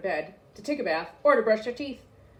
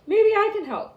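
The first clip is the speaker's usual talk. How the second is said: calling out; 12 semitones higher, 7 dB louder than usual. A narrator's reading. Child offers over storm.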